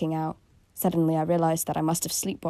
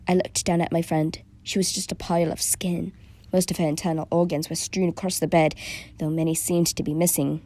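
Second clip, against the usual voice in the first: plain reading voice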